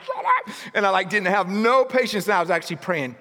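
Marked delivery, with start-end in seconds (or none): 0.0-0.5 high laughing shout-up; laughing mock shout. Imagined yell at the dog, laughing. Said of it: "I was like".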